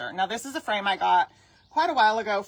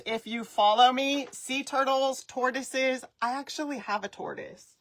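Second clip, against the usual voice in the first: usual speaking voice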